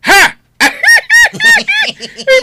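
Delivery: high, loud laughing through the words; giggling helplessly at the video; burst of laughter. Real, not acted. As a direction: crack up and laugh the line out. Watching funny video, bursts laughing.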